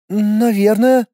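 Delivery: put-on hesitant drawn-out child's voice; a small boy, unsure. A narrator's reading. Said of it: "неуверенно протянул ребёнок". (неуверенно протянул, детский голос)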